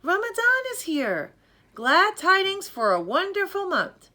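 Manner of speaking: joyous calling out. Acted. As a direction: shout glad news from the window. Neighbors calling out greetings.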